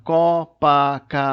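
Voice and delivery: "Copacabana" loud, co, pa, ca, ba, na; syllable by syllable. Shown as a demonstration. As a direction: break "Copacabana" into five separate, drawn-out beats. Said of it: "São cinco sílabas".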